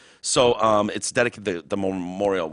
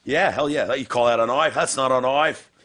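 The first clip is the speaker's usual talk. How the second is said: hammed-up Australian accent, higher and louder; 4 semitones higher, 5 dB louder than usual. Pretending to be the Australian Alexa, in a loud Australian accent. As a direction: channel a brash Aussie bushman in an Australian accent. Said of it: "change your voice to Australian accent".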